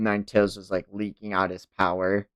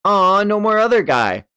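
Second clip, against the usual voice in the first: high, louder whine of letdown; disappointed aw; 11 semitones higher, 6 dB louder than usual. Real, not acted. Reacting to the chapter's end, disappointed. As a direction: sulk that it's over. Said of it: "reacts to chapter ending".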